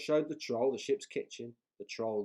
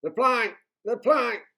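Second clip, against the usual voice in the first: loud, high chanting yell; pirates yelling; 13 semitones higher, 15 dB louder than usual. A narrator's reading. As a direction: pirates baying for the plank. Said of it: "yelled Ben Buckle and Percy Patch".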